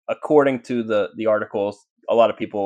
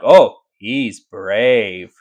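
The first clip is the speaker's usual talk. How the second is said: drawn-out, louder mock admiration; 7 dB louder, much slower than usual. Spirit taunting the investigator who banged, mocking drawl. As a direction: stretch it out loudly to taunt the newcomer. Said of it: "Like, mocking me".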